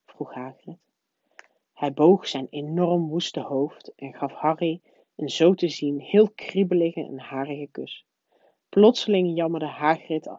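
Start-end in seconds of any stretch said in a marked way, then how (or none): none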